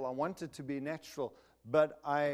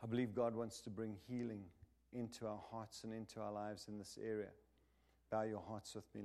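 prayer; 3 semitones lower than usual. Acted. Leads prayer.

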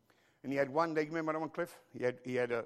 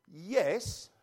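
ordinary talk, nothing marked

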